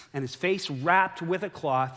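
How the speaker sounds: ordinary talk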